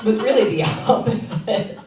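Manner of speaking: ordinary delivery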